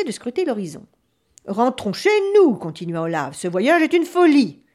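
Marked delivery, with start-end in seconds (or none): 1.5-2.5 loud, high-pitched appeal to turn back; raised, insistent voice; urgent plea. Acted. Frightened devin urges the chief home. 3.4-4.6 loud, high-pitched objection; raised, exasperated voice; urgent protest. Acted. Devin protests against the voyage.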